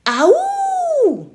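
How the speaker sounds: very high, loud released yell; loudest yell. A narrator's reading. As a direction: scream it out with everything. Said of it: "I yelled again and again".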